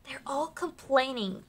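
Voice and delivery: soft secret remark; whispered aside. Real, not acted. Repeats line as aside.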